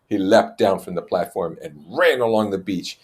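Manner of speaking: ordinary delivery, nothing marked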